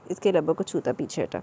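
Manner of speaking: ordinary delivery, nothing marked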